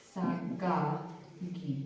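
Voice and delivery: साकागी stretched out slowly and softly; slow name demo. Shown as a demonstration. Teacher drills the class on the name, slow. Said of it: "इनका नाम है last में Simone साकागी".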